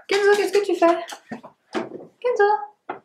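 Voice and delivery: high-pitched call across the room to the dog. Real, not acted. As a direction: call out sharply to a dog that's making noise. Calling her dog mid-video.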